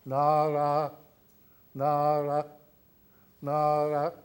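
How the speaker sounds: "na" held twice as long as "ra"; long and short vowels. Shown as a demonstration. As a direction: stretch "na", keep "ra" brief. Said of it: "twice as long as the other".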